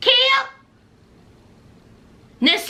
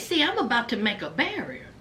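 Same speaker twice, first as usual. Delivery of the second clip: low, soft and quick; quiet explanation; 7 semitones lower, 8 dB quieter, faster than usual. Retold or imitated. Man explaining his pillow barrier, low and quiet. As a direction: explain it under the breath, matter-of-fact. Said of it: "And he said".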